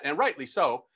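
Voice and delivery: ordinary talk, nothing marked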